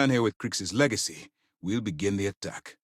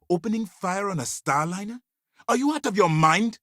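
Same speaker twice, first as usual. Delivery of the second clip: outraged scolding; 10 semitones higher than usual. Acted. Officer hearing of the starliner stunt, outraged scolding. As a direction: climb in pitch and volume to the question.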